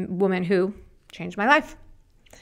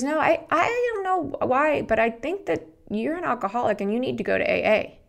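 stranger's gentle advice; 6 semitones higher than usual. Retold or imitated. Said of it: "and she goes".

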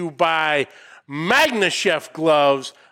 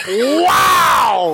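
spit take; 12 semitones higher, 6 dB louder than usual. Real, not acted. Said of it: "[name] just did a spit take".

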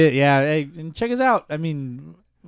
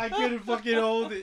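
laughing; 5 semitones higher, 3 dB quieter than usual. Real, not acted. Laughing after clip.

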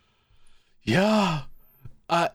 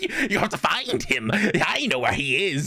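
put-on confused old lady voice; 7 semitones higher than usual. Old lady begging staff for her son, high pleading voice building to a cry.

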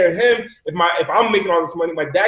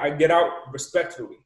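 normal talking voice

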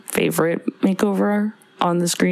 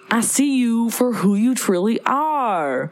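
slow, higher put-on Southern-Jamaican mix; imitated movie accent; 4 semitones higher than usual. Imitating the heroine's disguise voice for co-host, mixed Southern-Jamaican accent. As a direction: mimic the odd mixed accent, drawn out. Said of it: "she kinda talks like this".

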